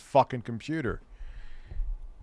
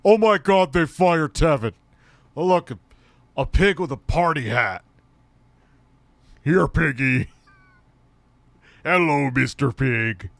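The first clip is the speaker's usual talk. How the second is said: slow motion voice; 5 dB louder than usual. Pretend. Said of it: "I'll do the slow motion voice".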